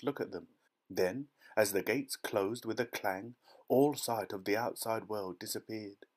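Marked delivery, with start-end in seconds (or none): none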